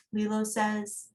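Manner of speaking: ordinary talk, nothing marked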